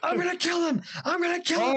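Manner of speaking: high mock-frantic threat; retold drunken rage. Drunk Edward grabbing for the machete, high mock rage. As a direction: imitate an emotional drunk raging. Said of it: "he was kind of emotional".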